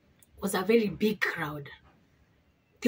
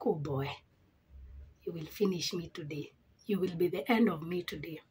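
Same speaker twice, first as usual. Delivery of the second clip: retold resigned aside; 5 semitones lower, 7 dB quieter than usual. Retold resigned muttering to herself.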